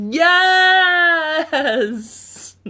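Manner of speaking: high, loud sung yes; excited cheer. Real, not acted. Streamer reacting to chat, loud happy cheer. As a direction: celebrate with a sung shout.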